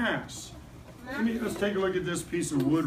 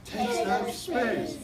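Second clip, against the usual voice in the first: slow, a bit higher, dramatic star voice; Hollywood movie star; 4 semitones higher, much slower than usual. Shown as a demonstration. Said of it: "say it like a, a Hollywood movie star".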